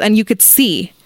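ordinary delivery

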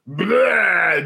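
drawn-out mock retch; mock vomiting noise. Host mimics salespeople spewing solutions, mock vomiting noise. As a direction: blurt it like spewing up. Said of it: "just solution vomiting".

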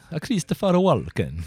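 mock Walken's bad Italian like a cartoon plumber; mock Italian accent. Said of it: "that Italian accent".

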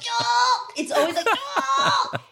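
high, fast, intense Korean drama line; hammy drama exclamation. Retold or imitated. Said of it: "쭉 내려간다".

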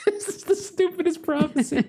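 high, squeaky voice breaking into laughter; helpless giggly aside; laughing. Real, not acted. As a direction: crack up while saying it. Cracking up at own prophecy.

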